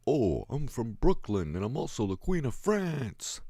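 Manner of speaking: hammy hint of Brooklyn; Brooklyn accent. Mocking actors ignoring accents.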